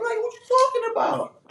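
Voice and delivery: high, baffled question; startled and high; high confused. Retold or imitated. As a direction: pitch it up like you can't believe him. Offered drugs in line.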